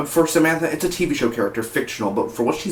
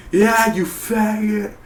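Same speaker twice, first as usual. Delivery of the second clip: high, drawn-out movie coach jeer; Rob Riggle; 10 semitones higher, much slower than usual. Retold or imitated. Reviewer quoting coach in movie, hammed-up high jeer. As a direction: imitate a coach jeering, stretched out. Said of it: "and he's like".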